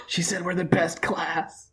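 hushed, slow aside; confiding aside. Acted. Student leans over to Aiko in class, hushed confiding aside. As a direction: lean in and pass it on softly. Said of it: "I lean over to Aiko and I say".

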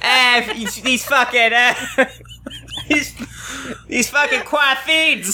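a mock Boston grumble, hamming it up; bad Boston accent. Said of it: "you're so much worse".

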